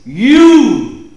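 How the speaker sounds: "you" drawn out, swelling high and loud; stress on YOU. Shown as a demonstration. Teaching where the Greek emphasis falls, heavy stress on "you". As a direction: hit "you" hard and stretch it. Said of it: "the emphasis here is".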